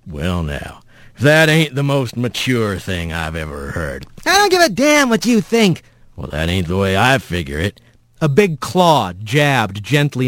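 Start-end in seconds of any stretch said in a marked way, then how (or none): 0.0-4.0 low, soft folksy rumble; amused rough voice. A narrator's reading. Mysterious voice chides sulking Rat, low and amused. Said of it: "A chuckle behind him". 4.2-5.8 high-pitched defiant retort; angry Rat. Acted. Rat rebuffs the voice behind him, high and angry. 6.1-7.8 low, folksy rumble; calm rough voice. Acted. Mysterious voice disagrees with Rat, low and unruffled.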